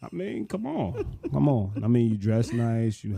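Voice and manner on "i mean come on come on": high mock plea. Teasing her, mock pleading.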